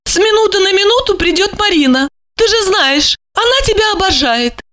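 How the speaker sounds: a hostess making plans; high female voice; chatty lady. A narrator's reading. Olga voiced by narrator.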